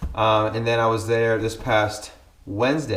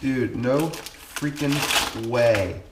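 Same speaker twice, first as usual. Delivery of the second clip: very slow, drawn-out real amazement; stunned disbelief; much slower than usual. Real, not acted. Vlogger first seeing the surprise gift, stunned. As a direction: stretch each word out in stunned disbelief.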